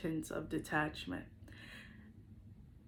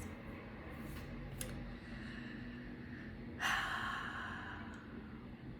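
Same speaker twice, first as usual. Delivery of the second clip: long sigh; 13 dB quieter than usual. Real, not acted. Pause after emotional encouragement.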